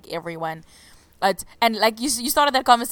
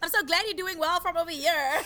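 mock polite; 8 semitones higher, 4 dB quieter than usual.

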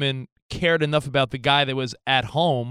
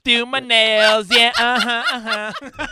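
higher, slow, louder mock [name] sass; 6 semitones higher, 4 dB louder, much slower than usual. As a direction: flip your hair and vamp it up slowly, a high hammed-up diva. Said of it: "I'm the [name] of values".

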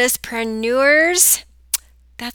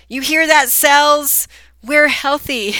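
playful shout; 5 semitones higher, 10 dB louder than usual. Pretend. Shouting playfully at her own cells.

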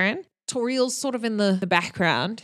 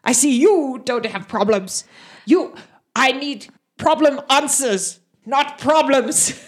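orc scolding; 4 semitones higher, 4 dB louder than usual. Acted. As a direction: lecture him like a frustrated brute. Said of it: "and he says".

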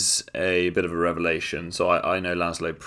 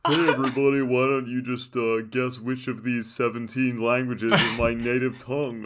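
mocking impression; 3 semitones higher than usual. Mocking friend's humble-brag video.

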